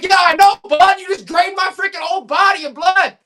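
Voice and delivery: high, loud comic outburst at the doctor; mock outraged yell. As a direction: holler it high in hammed-up outrage. Retold outburst after blood draw.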